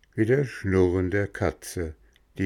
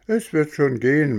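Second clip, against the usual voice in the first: put-on higher, louder eager boy voice; a keen lad jumping at the offer; 8 semitones higher, 5 dB louder than usual. Röhrle insists to coachman.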